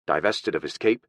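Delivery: usual speaking voice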